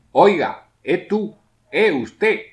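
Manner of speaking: high, loud calls to someone; calling out. Shown as a demonstration. Teacher demonstrates calling someone, high and loud. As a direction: hail a stranger across the street. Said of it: "Al principio y al final de las apelaciones".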